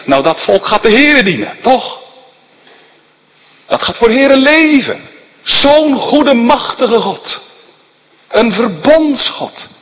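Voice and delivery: loud, high preaching voice. Acted. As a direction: preach it out with fire. Preaching at full force. (vurig prekend)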